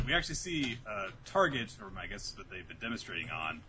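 normal talking voice

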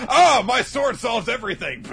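loud, high, cocky battle cry; hammed-up hero bellowing his solution; triumphant shout. Asta boasting his sword fixes everything, shouting.

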